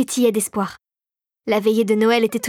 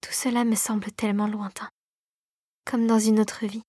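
murmured; 6 dB quieter than usual. A narrator's reading. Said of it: "Marie murmura".

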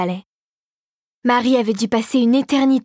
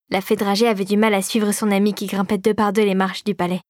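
usual speaking voice

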